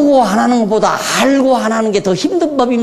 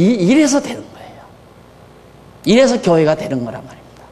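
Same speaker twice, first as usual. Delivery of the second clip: ordinary talk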